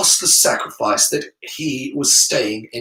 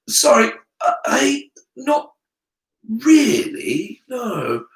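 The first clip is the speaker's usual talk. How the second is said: put-on halting, higher, awkward boy; stuck voice; 8 semitones higher, slower than usual. Harry struggling to answer the headmaster, stuck and halting. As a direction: stammer it out with gaps, falling away at the end. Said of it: "His voice seemed to be stuck".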